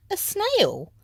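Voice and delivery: very high alarmed squeal; shocked shriek. A narrator's reading. Teacher spotting the snail, very high shocked cry. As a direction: shriek in disbelief.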